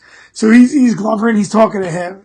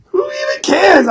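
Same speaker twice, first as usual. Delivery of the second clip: higher, slow, half-shouted brag; excited self-talk; 9 semitones higher than usual. Retold or imitated. Fighter hypes himself up mid-fight in a high slow shout. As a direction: crow it to yourself mid-fight. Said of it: "And I was like".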